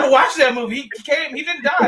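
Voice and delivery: high, laughing protest; laughing disbelief. Retold or imitated. Telling friends he watched the movie, high and laughing. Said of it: "I said".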